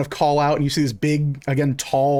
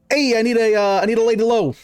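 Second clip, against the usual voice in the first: calling out an order; 7 semitones higher, faster than usual. Acted. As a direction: shout the order across the diner. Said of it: "walks over, goes".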